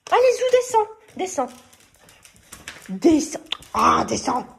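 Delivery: loud, high shooing of the dog; loud exasperated scold. Real, not acted. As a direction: order the dog off the bed, fed up.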